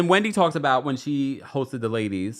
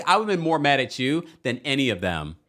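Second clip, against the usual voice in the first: ordinary talk, nothing marked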